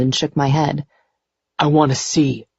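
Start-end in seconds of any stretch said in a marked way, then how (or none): 1.6-2.5 subdued, slightly hoarse request. A narrator's reading. Shaken man reaching for her wrist, mildly hoarse. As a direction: ask it with a touch of hoarseness. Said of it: "he said hoarsely".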